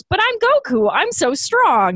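high, goofy boast; mock Goku boast. Podcaster mimics Goku boasting to Vegeta, high and goofy. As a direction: puff up like a naive hero, high and proud.

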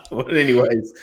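high burst of laughter, then laughing into the words; real laugh. Real, not acted. Laughing at a cohost's joke about Tom. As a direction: laugh it off, still chuckling.

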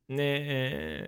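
a soft, lazy vocal-fry nah; creaky refusal. Shown as a demonstration. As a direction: drag a reluctant nah out in fry. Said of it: "those kind of sounds".

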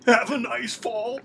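high, slow taunting quip; sing-song gloating one-liner; mock villain quip. Mock quip as Jimmy trips.

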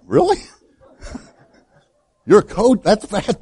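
sudden high-pitched disbelief, breaking into a laugh; shocked disbelief. Retold or imitated. Preacher retelling his shock at the coach, laughing disbelief. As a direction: scoff at him in amused shock. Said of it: "Now, I was shocked".

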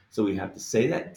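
normal talking voice

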